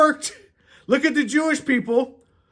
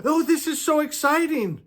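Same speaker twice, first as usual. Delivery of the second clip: lightly voiced eager kid; 5 semitones higher than usual. Preacher voices the child excited to see Joshua, higher childish voice. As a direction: gush like a thrilled little kid. Put yourself in the little kid's shoes.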